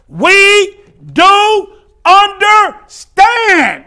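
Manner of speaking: very slow, high shout, word by word; drawn-out shout. Acted. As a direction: belt out each word with long stretches. Same words repeated at full force.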